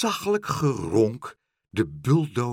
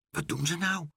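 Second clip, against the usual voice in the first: whispered; 5 semitones higher, 5 dB quieter than usual. Acted. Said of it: "fluisterde meneer Pen".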